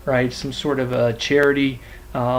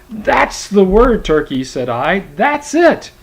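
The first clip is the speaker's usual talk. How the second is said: triumphant; 6 semitones higher, 5 dB louder, slower than usual. Acted. Narrator catches Turkey's slip.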